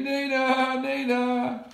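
high loud siren nee-naw; siren imitation. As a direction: wail it like a police siren. Police siren sound.

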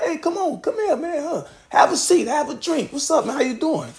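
high, friendly welcoming voice; high-pitched hospitable greeting; elders welcoming him. Retold or imitated. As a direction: greet the young brother like a host.

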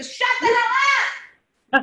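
high, drawn-out shout at the crew; mock yell. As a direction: holler it slowly, way up high. Mock yelling at her crew.

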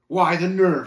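loud indignant outburst; exploding in anger. Acted. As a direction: burst out, livid. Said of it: "exploded talkative".

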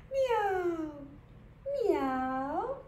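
drawn-out, gliding kitty meow; cat meow. Shown as a demonstration. Instructor answering what the cat says, gliding meows. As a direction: mew like a cat calling. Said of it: "What does the cat say?".

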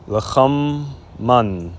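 'lachaman' very slow, broken as lacham - man; syllable-split Hebrew. Shown as a demonstration. Teacher breaks down feminine form, slow split 'lachaman'. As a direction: stretch 'lachaman', hold the m, then finish on n. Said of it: "you would say".

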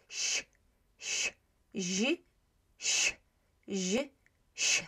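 "Sh" unvoiced then "zh" voiced, back and forth; alternating ś and ź. Shown as a demonstration. Teacher drilling voiced against unvoiced pairs. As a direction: switch between hissed "sh" and buzzed "zh". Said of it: "try practicing with your hand".

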